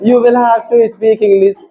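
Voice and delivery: very slow, each word drawn out; slow stretched English. Shown as a demonstration. Teacher models the phrase to students, very slow. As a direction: drag it out with a laugh in the voice. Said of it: "बोलो, you will have to।".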